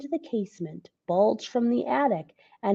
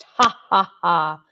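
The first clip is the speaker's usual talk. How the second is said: louder hearty ha ha; vast laugh; 4 semitones lower, 7 dB louder than usual. Acted. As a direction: let the laugh roll out wide. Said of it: "Laughed vast".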